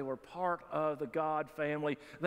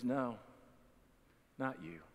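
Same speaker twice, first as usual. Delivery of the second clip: tender denial; 6 semitones lower, 5 dB quieter than usual. Retold or imitated. Jesus reassures the sinner.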